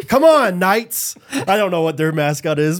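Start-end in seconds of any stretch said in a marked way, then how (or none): 0.1-1.2 loud, raised rallying call; mock team rally. Method-acting as the other team, loud hammed-up rallying cry. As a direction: urge on your team, hammed up.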